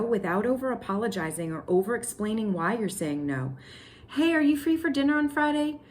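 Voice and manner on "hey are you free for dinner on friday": acted inviter.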